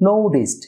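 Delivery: নোটিসড as "NOU-dist"; t becomes d in নোটিসড, falling; correct pronunciation. Shown as a demonstration. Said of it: "এর উচ্চারণ হবে". (নোটিসড-এর সঠিক উচ্চারণ, "নোউডিস্ট")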